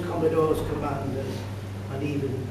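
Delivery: ordinary talk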